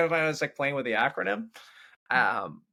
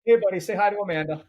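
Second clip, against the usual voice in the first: cheerful and quick, like calling a little son over; 8 semitones higher, 4 dB louder, faster than usual. Real, not acted. Dad asks his son to greet the podcast host, in a bright fast voice.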